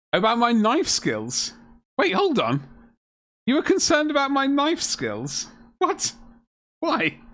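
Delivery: high-pitched disbelief; incredulous. Real, not acted. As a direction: question it in baffled, rising high tones. Incredulous at viewer doubting his skills.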